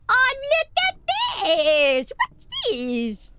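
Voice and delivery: delighted discovery; excited. Real, not acted. Excited at something on screen.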